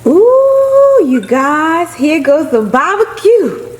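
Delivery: high, loud, slow excited call; excited showing off. Real, not acted. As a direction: announce the dish with real thrill. Showing off her dinner.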